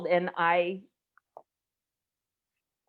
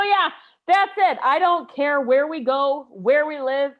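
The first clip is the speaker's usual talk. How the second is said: emphatic; 4 semitones higher, 6 dB louder than usual. Retold or imitated. Emphatic retold reply.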